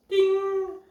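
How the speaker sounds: very high, loud sung "ding"; imitated bell chime; elevator ding.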